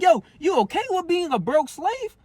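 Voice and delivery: high mocking challenge; taunting question. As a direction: needle them from way up high. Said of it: "I'm like".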